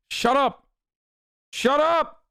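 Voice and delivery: very high-pitched, exasperated squeal; exasperated retort. Real, not acted. Streamer to chat teasing the sports mistake, high exasperated retort.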